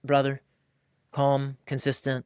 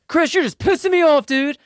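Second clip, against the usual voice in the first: high, loud hammed-up irritated wife; mock angry wife; 8 dB louder than usual. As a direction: play the wife snapping at her husband, overdone. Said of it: "Jermichael's scared".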